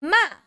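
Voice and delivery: MA falling sharply from high; short falling tone on MA; fourth tone. Shown as a demonstration. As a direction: start "ma" high and drop it. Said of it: "this is the fourth tone".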